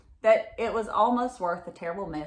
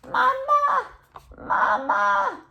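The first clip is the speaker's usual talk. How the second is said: very high, loud calling out for Mama; baby bear calling; 6 dB louder, much slower than usual. Acted. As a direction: call like a small child from the next room. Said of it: "Honey Bear's cry of".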